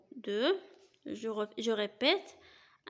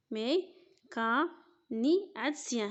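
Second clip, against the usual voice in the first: \"مي، كا، ني، عاد سيان\" split into separate slow syllables. Shown as a demonstration. Teacher splitting mécanicien into syllables, slow. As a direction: break mécanicien into syllables, pausing between. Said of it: "غنقسموه بحال دابا".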